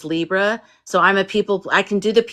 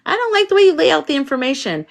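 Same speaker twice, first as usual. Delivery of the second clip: picky complaint; 9 semitones higher, 3 dB louder, faster than usual. Retold or imitated. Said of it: "You're like".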